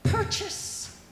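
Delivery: airy whispered purchase; mock purchase click. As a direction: breathe it like a checkout sound. Mock store announcer click.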